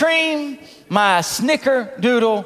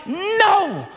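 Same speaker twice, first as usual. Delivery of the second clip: mock outraged shout; 7 semitones higher, slower than usual. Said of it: "I'm like".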